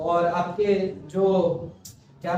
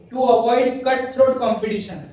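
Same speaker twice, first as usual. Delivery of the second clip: slow dictation; 3 semitones higher, 3 dB louder, much slower than usual. Shown as a demonstration. Dictates a definition slowly.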